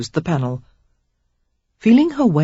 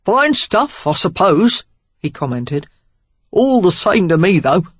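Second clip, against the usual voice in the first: loud, careless Cockney remark; 2 semitones higher, 4 dB louder than usual. A narrator's reading. Stranger swigging wine, careless Cockney. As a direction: shrug off fine wine in Cockney. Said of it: "a healthy dose of Cockney in his accent".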